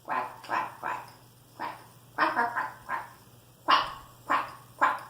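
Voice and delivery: low, raspy play quacks; short repeated duck calls; quacking like a duck. Pretend. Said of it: "we have to quack like a duck".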